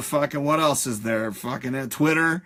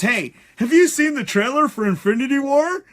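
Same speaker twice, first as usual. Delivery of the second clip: higher, louder mocking voice of the guy behind; mock moron voice; 9 semitones higher, 5 dB louder than usual. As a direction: ham up the eager idiot. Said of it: "the buddy turns to his other buddy and says".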